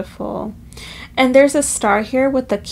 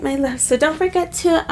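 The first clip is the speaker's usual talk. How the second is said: ordinary delivery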